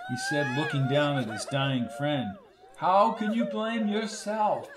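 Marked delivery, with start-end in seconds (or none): 2.8-4.7 high, slow questioning voice; concerned Reverend. A narrator's reading. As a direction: question him with drawn-out concern. Reverend consoles distraught man in story.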